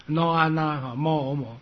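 each consonant joined to its vowel, 那 then mo; syllable spelling demo. Shown as a demonstration. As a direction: sound out no-啊-那, then mo-o-mo. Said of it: "这个梵字怎么拼音".

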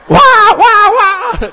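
high, loud drawn-out mock wail; hammed-up crying wah-wah-wah; fake wailing. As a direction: bawl like a child throwing a tantrum. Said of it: "就哭起来了".

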